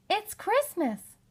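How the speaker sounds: high, slow gleeful announcement; excited. Acted. Children telling parents it's Christmas, gleeful. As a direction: savour the big news.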